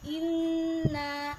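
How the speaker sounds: inna loud with the nn pressed and hummed; pressed nasal inna. Shown as a demonstration. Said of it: "harus ditekan dan didengungkan".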